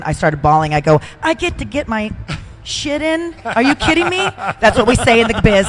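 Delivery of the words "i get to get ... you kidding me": higher, incredulous retelling; retold disbelief. Wrestler retelling hearing she could do all her moves, surprised. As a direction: relive that stunned happy disbelief. Retold joy at rumble news.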